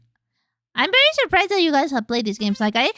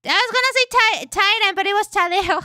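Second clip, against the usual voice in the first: excited high voice; 10 semitones higher, 3 dB louder than usual. Real, not acted. Excited streamer reacting to game.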